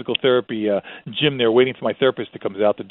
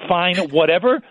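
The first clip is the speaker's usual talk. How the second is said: "fine, whatever" higher, "whatever" rising at the end; wife's dismissive "whatever"; 6 semitones higher, slower than usual. Shown as a demonstration. Wife finishing the argument with husband, dismissive "fine, whatever". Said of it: "then it's really bad".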